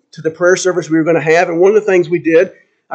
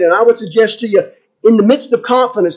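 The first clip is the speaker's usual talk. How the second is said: normal talking voice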